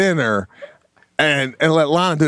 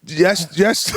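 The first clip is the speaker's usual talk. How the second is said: normal talking voice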